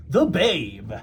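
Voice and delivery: a hint of a New York sign-off; New York accent. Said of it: "I don't know if you should do that voice".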